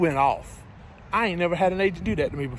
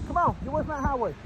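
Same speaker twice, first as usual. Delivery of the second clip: raised, higher warning call to the dog; 8 semitones higher, 4 dB quieter than usual. Real, not acted. Trucker warning his dog off the highway, higher call. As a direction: call him back from the road.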